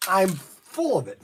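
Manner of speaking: usual speaking voice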